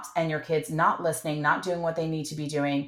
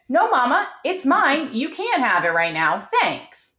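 mock child refusal; 6 semitones higher, 4 dB louder than usual. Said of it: "That's a legit answer".

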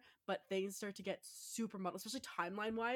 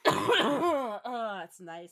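ordinary talk, nothing marked

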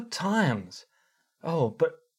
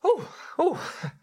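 chicken clucking. Pretend.

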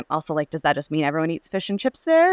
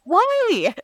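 incredulous why; 3 dB louder than usual. Real, not acted. Echoing baffled why, squeal.

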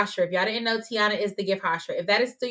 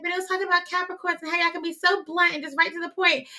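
mom's voice; 8 semitones higher than usual. Retold or imitated. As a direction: play the mom, prim and sweet. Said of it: "She doesn't talk like that".

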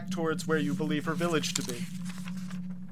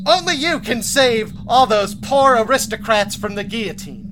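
pompous billionaire; 3 semitones higher, 5 dB louder than usual. Acted. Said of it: "He said".

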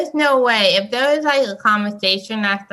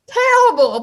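high loud exclamation; emphatic; 6 dB louder than usual. Real, not acted. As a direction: really punch it out. Emphatic rejection of advice.

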